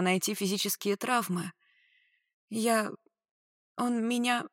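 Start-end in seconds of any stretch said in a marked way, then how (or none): 2.5-4.4 high, halting, tearful attempt; voice breaking. A narrator's reading. Woman trying to tell rescuer, voice breaking. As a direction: break off between words, near sobbing. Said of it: "Голос срывался на всхлипы и рыдания".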